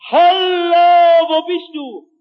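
calling out high and loud across the prairie; rescuers calling. Retold or imitated. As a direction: call out loud to a lost man. Retter rufen in der Prärie. (laut rufend über die Prärie)

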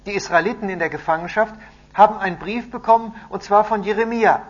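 high, loud, emphatic announcement; proclaiming. A narrator's reading. Messenger tells captives of Jeremiah's letter, loudly proclaiming. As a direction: deliver great news to everyone.

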